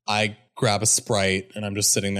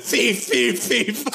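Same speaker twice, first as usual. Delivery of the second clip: mock alarm call; 11 semitones higher, much slower than usual. Mock shouting at thief.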